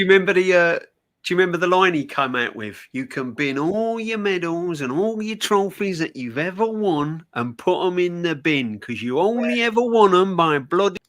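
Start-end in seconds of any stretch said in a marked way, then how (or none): 2.9-11.0 put-on a lightly done Brian Clough voice with an East Midlands accent; half-serious football manager impression, Midlands tone. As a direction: quote the manager in a mild Midlands twang. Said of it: "That's a great accent".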